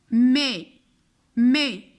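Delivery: "مي" said loud and clear with a closed é; teacher's firm model of "مي"; modelled syllable. Shown as a demonstration. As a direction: sound out "مي" as a single drawn syllable, mé. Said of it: "اي سو برونونس مي".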